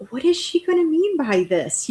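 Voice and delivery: high-pitched, wondering quote of a thought; retold puzzlement. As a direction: replay your own puzzled reaction, pitched high. Retelling her first puzzled reaction.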